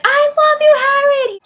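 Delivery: high, loud retold fan cry; fan scream. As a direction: belt it to the stage like a fan. Girl behind screaming to [name].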